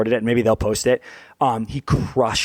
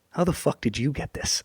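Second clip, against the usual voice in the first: hushed, stunned disbelief; imitated shock; 2 semitones higher, 7 dB quieter than usual. Imitating his friend handed the surprise card, hushed shock. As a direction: ask it softly, as if stunned. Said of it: "he was just so shocked".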